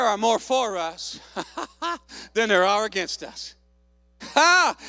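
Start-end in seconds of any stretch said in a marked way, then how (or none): 4.3-4.8 loud, raised burst of triumph; exultant "ha". Real, not acted. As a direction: punch out a victorious "ha". Exultant outburst at good report.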